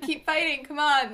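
loud mock cheer to keep fighting; laughing mock rally cry; mock producer cheering. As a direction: egg them on like a pushy producer.

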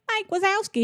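high-pitched imitated child; little kid voice. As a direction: pipe it up like a small kid. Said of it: "her little voice".

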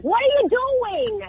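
high, drawn-out New York challenge; New York attitude. Shown as a demonstration. Demonstrating the New York way of yelling, with exaggerated attitude. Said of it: "the New York attitude".

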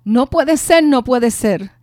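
grito agudo y fuerte, desesperado; grito de incredulidad. Retold or imitated. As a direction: gritar que no puede ser, roto. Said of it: "un grito que él dice". (grito fuerte de incredulidad)

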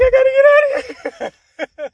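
high, loud, slowed laughing squeal; laughing panic. Retold or imitated. Telling his friend he had to get out: high, laughing squeal. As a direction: laugh out the wish to flee. Wanting to escape.

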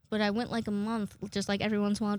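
ordinary talk, nothing marked

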